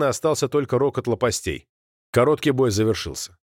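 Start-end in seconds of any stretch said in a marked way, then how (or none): none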